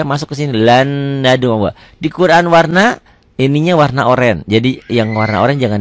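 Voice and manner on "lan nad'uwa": slow "Lan nad'uwa", one long held n; merged n. Shown as a demonstration. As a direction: run "Lan" into "nad'uwa" on a sustained nasal. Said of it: "huruf matinya jangan dibaca".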